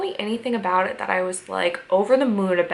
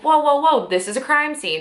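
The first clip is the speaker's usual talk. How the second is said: Scully objecting; 6 semitones higher, 3 dB louder than usual. Retold or imitated. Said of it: "Scully's like".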